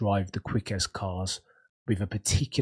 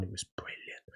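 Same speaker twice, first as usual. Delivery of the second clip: breathy hushed whisper, much softer; 15 dB quieter than usual. Real, not acted. To viewers about a beloved F1 manual, hushed whisper. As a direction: whisper it like a fond secret.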